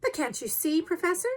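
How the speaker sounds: high-pitched, exasperated appeal; testy, worked-up questioning; irascible protest. A narrator's reading. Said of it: "said our irascible companion".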